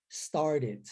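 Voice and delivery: "Started" with a tapped, D-like middle T; American flapped T. Shown as a demonstration. Teacher repeating the American form for students, tapped T in "Started". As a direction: say "Started" as "starded", American-style. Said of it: "eu vou transformar esse T em R".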